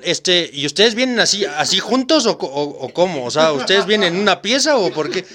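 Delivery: loud, pushy street-interviewer voice; playing a loud YouTuber teasing a couple at the pool; Chiquirulo impression. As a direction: hype it up like a loud vlogger grilling strangers. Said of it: "me hubiera encantado ver al Chiquiculo hacer dinámicas ahí".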